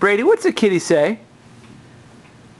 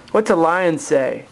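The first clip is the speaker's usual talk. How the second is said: ordinary talk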